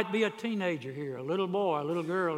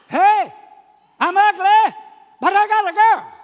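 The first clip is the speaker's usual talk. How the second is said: very high, very loud bragging yell; boy crowing at the top of his voice about his new girl; triumphant shout; 17 dB louder than usual. Acted. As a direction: shout it to the whole world, overjoyed.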